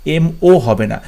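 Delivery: ordinary talk, nothing marked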